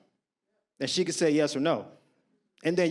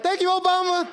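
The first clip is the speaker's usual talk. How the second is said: grateful squeal; 11 semitones higher, 4 dB louder than usual. Retold or imitated. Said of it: "I'm like".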